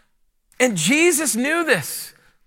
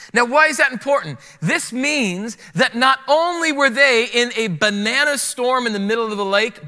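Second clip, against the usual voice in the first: normal talking voice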